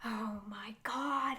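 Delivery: slow, whispered oh my God; awed whisper. Real, not acted. Vlogger overwhelmed by choices, whispering. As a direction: breathe it out in awe. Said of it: "I get way too excited about this".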